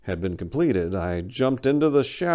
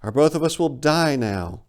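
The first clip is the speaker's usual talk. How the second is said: lightly voiced bird, higher and firm; a hint of a bird voice laying down the rule; bird stating flatly; 8 semitones higher, 4 dB louder than usual. Big bird coldly reminding the narrator, lightly voiced. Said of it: "the big bird stated flatly".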